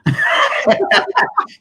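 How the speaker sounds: high burst of laughter; amused laugh. Real, not acted. Speaker laughing at being called inept, high amused cackle.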